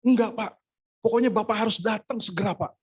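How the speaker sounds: high-pitched, pressing demand; imitating a caller who won't take no; insistent caller. Insistent caller refuses his objection.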